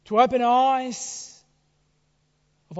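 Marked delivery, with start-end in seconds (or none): 0.1-1.4 louder, higher and slow; emphatic. Real, not acted. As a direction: lift the voice and draw it out. Preacher builds to a peak.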